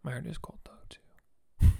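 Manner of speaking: whispering. Acted. Joking aside, whispered.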